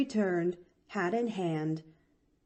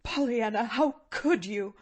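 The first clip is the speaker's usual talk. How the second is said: mild moan; 4 semitones higher than usual. A narrator's reading. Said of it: "moaned the woman".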